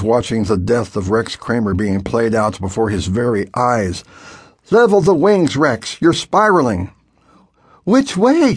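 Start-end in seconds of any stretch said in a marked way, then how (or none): 4.7-6.9 urgent instruction. A narrator's reading. 7.8-8.6 much higher, urgent question; scared boy asking over the radio, much higher; anxious question. A narrator's reading.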